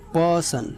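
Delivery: Person with a long, stressed opening पर; stress on first syllable. Shown as a demonstration. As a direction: stretch the start of person. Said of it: "इस word में जो stress होगा". (Person की शुरुआत पर ज़ोर)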